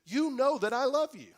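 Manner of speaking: hurt pride pushing back hard; indignant. Acted. Peter answering Jesus's third question, indignant and loud. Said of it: "he gets a little indignant".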